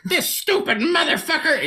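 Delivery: put-on high squeaky kid voice cursing at a game; imitated angry kid. Dad imitating his daughter swearing at the iPad, high and squeaky. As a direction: mimic a little girl raging at her iPad.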